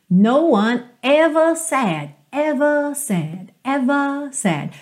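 "ever" drawn out long and slow; stretched ever. Shown as a demonstration. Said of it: "Percebe como eu estico".

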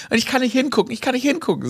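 high, whiny can't-look panic; squeamish panic. Retold or imitated. Friend who can't look at the injury, high whiny panic. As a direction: plead it like you can't bear to see blood. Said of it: "sagt N".

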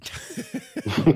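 suppressed giggling laugh; a burst of held-back laughter; stifled giggles. Real, not acted. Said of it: "Hör auf zu lachen, [name]".

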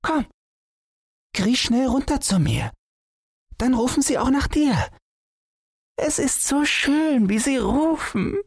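put-on high, eager child voice; a delighted small boy coaxing his dad; little boy. Hidden boy inviting his father under the boat, high gleeful child voice.

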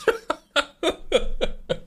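high laugh. Real, not acted. Laughs at own joke.

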